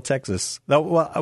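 usual speaking voice

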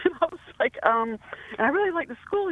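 giggling while she admits it; laughing. Real, not acted. Caller to the host about the Pope school, laughing.

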